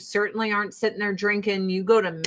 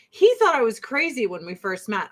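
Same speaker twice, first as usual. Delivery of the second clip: normal talking voice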